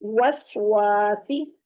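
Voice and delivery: वसवासी drawn out slowly with rounded w sounds. Shown as a demonstration. Teacher modelling with rounded lips, slow careful w. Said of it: "होंठों को गोल रखेंगे".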